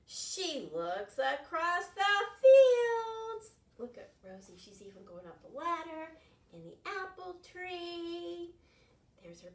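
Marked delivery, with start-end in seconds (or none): 0.1-3.5 slow, louder, higher read; dramatic slow reading. Acted. Teacher reading slowly for suspense. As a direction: stretch it out dramatically.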